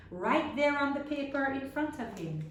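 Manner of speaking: plain reading voice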